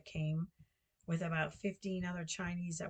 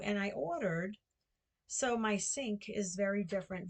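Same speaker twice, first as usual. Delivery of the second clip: ordinary delivery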